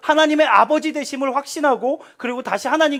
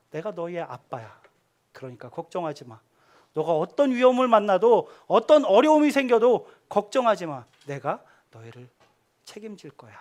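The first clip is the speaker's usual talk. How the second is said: gentle reassurance; 4 semitones lower than usual. Pretend. God reassuring his children.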